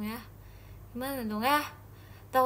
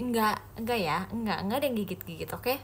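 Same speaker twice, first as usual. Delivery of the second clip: normal talking voice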